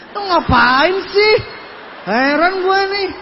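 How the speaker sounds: high, loud baffled complaint; mock bewilderment. Acts out bewilderment at empty room.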